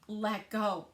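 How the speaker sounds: plain reading voice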